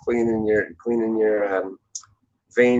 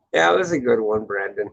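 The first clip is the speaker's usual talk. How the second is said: usual speaking voice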